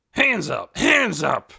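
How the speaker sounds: loud shouted police order; yelled command. A narrator's reading. Officer yelling at the suspect to raise his hands. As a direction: bark it like a TV cop. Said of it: "yelled Officer Gurney".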